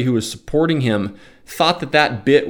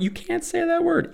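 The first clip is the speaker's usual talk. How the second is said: much higher, softer scolding interjection; imagined scold; 6 dB quieter than usual. Pretend. An imagined critic cutting him off, high scolding squeak. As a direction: squeak out a prissy objection.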